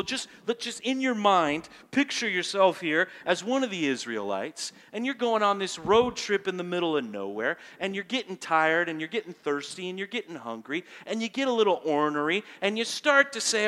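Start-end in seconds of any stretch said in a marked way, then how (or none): none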